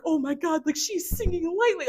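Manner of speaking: high, rushing burst of amazement; excited. Retold or imitated. Dreamer freaking out over a woman singing to her, excited. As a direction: gush like meeting a celebrity. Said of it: "I just got so excited".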